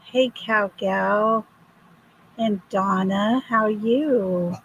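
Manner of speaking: ordinary delivery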